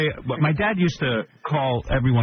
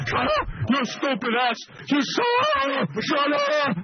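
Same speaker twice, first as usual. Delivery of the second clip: high, slow, shrieking dad; exaggerated furious yell; retold dad screaming; 14 semitones higher, much slower than usual. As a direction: shriek it like an enraged father.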